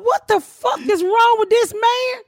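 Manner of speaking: high, drawn-out mock outrage; panicked neighbor. Neighbor going off on the sheriff, mock panic. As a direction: stretch it out slowly in a high, imitated panic.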